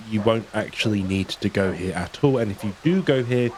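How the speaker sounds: normal talking voice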